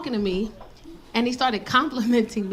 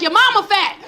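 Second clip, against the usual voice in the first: mock mad comeback; 8 semitones higher, 4 dB louder than usual. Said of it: "I got so mad".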